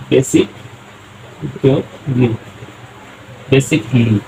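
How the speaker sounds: বেসিকলি built up slowly, বেসিক then লি, silent a dropped; spelled-out pronunciation. Shown as a demonstration. Teacher shows students বেসিকলি without the silent a. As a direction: say বেসিকলি with no a sound before লি. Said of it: "এই জন্য হবে উচ্চারণটা হবে".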